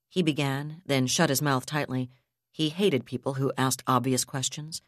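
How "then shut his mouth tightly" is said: ordinary delivery, nothing marked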